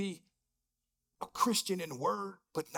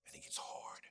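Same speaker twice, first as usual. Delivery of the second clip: soft whispered admission; whispering; 14 dB quieter than usual. Real, not acted. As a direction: barely voice it. Pastor drops voice mid-sermon.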